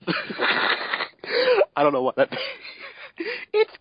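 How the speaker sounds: high, breathless laughing squeak. Real, not acted. Laughing at the terrible fanfic line, very high. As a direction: crack up mid-line. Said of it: "Oh, that, that, that's it".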